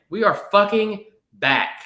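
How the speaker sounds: triumphant shout. Real, not acted. Host celebrates football's return to listeners, slow and loud. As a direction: shout it like the season's finally here.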